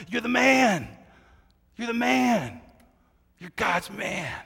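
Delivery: high, loud acted praise; awed servant. Obadiah bowing to Elijah, hailing him loudly. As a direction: shout it up at the prophet. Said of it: "He says".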